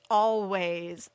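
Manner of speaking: grinning, loud and cheeky; cheeky grin. Retold or imitated. Mother grinning at her sister, cheeky and loud. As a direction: own it with a sly grin. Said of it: "she gets this big grin on her face and she says".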